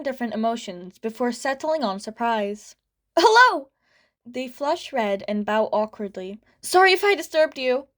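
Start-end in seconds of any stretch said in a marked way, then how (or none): 3.2-3.7 startled, much higher and louder hello; surprised squeak. A narrator's reading. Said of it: "a squeak of surprise". 6.6-7.9 put-on flustered kid. A narrator's reading. Embarrassed kid apologises to hero.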